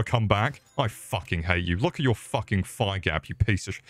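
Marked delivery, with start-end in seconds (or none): none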